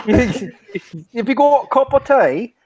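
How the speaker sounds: put-on high, caricature British accent; mock UK accent. Asked for a UK accent, exaggerated British caricature. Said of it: "do your U K accent".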